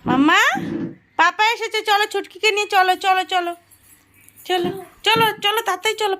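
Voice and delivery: high, loud and thrilled, calling the little one. Real, not acted. Mother calls her toddler to see papa arriving, high excited call. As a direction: sing it out, papa is home.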